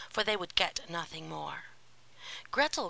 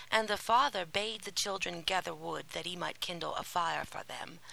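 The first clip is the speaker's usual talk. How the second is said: plain reading voice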